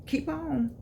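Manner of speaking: lightly mock a mom cautioning her child; mild imitation of a warning mother. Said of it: "how your mom used to say".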